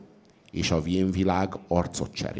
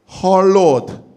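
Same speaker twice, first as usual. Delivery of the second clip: much higher and louder "Hallod?"; pointed question; 11 semitones higher, 9 dB louder than usual. A narrator's reading. Saint Cung turning to the sage, sharply challenging. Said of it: "nézett a bölcsre Szent Cung".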